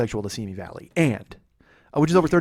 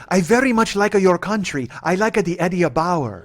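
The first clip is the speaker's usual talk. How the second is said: higher, hammed-up Italian accent; 5 semitones higher, 3 dB louder, slower than usual. Quoting his Guatemalan passenger, mock Italian accent. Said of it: "she has an Italian accent".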